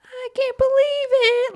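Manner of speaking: high, loud squeaky cartoon kid; shrieking cartoon kid, way up high. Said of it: "this kid ... he's like".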